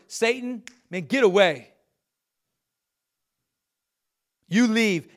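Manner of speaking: stern casting-out command; forceful rebuke. Acted. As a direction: drive the devil out. Paraphrased rebuke of Satan.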